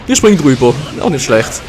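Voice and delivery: usual speaking voice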